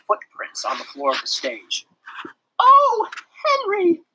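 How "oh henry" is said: high, loud dismayed wail. A narrator's reading. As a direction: cry out in dismay. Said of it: "wailed Miss Roop".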